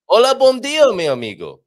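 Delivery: exaggerated, ringing greeting after finding the note; folk singer's tuned note. Shown as a demonstration. Demonstrating how to tune up a Portuguese accent, hammed-up loud hail. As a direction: launch loud and high, then drop. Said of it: "they get the note".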